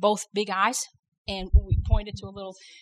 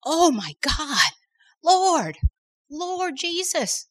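desperate cry to God; 7 semitones higher, much slower than usual. Retold or imitated. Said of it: "Out of my spirit, I said".

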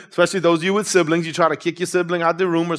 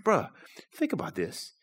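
quiet retold warning in the dark; hushed warning; 11 dB quieter than usual. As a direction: lean in and hush it like a worried friend. Brother's hushed warning in dark boat.

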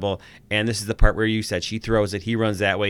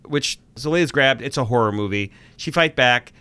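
normal talking voice

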